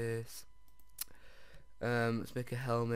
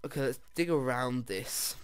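normal talking voice

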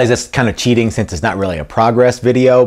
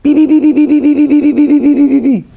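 rapid high-pitched gibberish babble, loud; Cousin It; 6 dB louder than usual. Acted. Guitar builder trying out a Halloween character, high creature chatter. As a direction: chatter in high, loud, repeated little syllables. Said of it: "Maybe I should be Cousin It".

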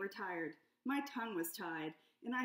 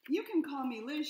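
lightly raised lizard lady voice; a touch higher, eager client; a hint of a fretful client; Liz's voice; 4 semitones higher, 6 dB louder than usual. Liz voiced by narrator.

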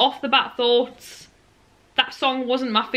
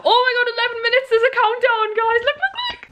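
high-pitched, rushed squeal of excitement; giddy high-pitched rush; excited squeal; 13 semitones higher than usual. Real, not acted. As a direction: squeal it out fast, thrilled at the countdown. Countdown to film premiere appears.

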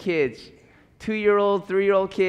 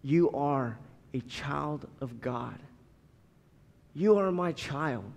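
gently reassure, much softer; God speaking tenderly; 6 semitones lower, 6 dB quieter than usual. Pretend. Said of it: "And God says".

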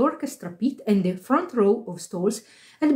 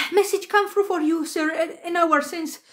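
slightly acted, higher-pitched messenger; lightly voiced doorkeeper; 7 semitones higher than usual. A narrator's reading. Doorkeeper brings manager a message, lightly acted high voice. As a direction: report it in a raised pitch.

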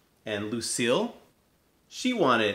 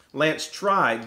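usual speaking voice

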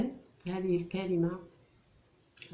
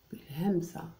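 whispered hint. Shown as a demonstration. Teacher whispering a hint to the pupils. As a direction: breathe بالهمزة as a hint. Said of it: "whispered hint to pupils".

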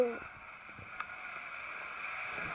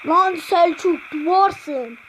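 ordinary delivery

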